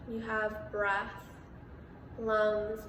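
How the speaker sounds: measured meditation voice with long pauses; guided meditation. Real, not acted. Meditation host guiding listeners through breath and body awareness, calm and spacious. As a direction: guide listeners into stillness, unhurried, pausing between phrases.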